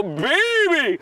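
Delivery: high cheering yell; excited whoop. Real, not acted. Cheering at the start.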